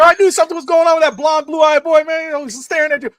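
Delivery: high, loud comic tirade; hammed-up shouting about a suspected crush; mock outraged rant. Dad ranting to daughter about blue-eyed boy, high hammed-up outrage.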